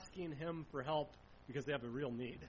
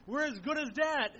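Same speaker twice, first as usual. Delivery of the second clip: higher, louder cry of doom; desperate sailors' cry; 7 semitones higher, 6 dB louder than usual. Retold or imitated. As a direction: shout it as if the boat is sinking. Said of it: "those were words from seasoned sailors".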